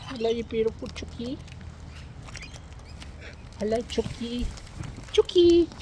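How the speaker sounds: high, soft coaxing coo. Real, not acted. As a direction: coax the hens sweetly. Cooing at the chickens.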